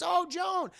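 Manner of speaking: high, eager calling out; excited greeting. Acted. Mother spotting Joan in parking lot, high excited hello. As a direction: call to her across the lot, thrilled. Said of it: "She goes".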